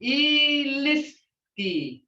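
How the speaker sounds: teacher spelling Iliski out in sound; slow sounding out. Shown as a demonstration. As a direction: model Iliski slowly for the class. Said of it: "Look here, the sound".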